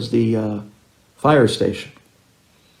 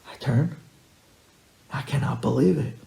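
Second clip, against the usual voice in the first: ordinary delivery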